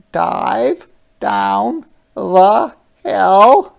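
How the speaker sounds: high, loud, very slow otter; a playful otter sliding. Acted. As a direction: otter diving down. Otter speaks in story.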